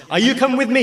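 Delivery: high-pitched retold question; retold rallying call. Retold call to each person.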